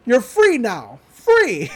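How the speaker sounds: acted-out jubilant shout; triumphant cry. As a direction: cry out freedom in a mock-heroic retelling. Acts out freeing the pool ducks.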